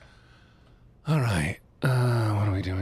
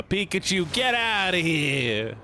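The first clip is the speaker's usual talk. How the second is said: mock shout; 9 semitones higher, slower than usual. Yelling at Pikachu.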